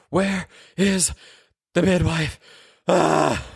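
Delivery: high, strained shriek of a woman giving birth; mother in labor screaming for the midwife. Acted. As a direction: cry out for the midwife mid-labor. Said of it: "screaming, like, really loudly".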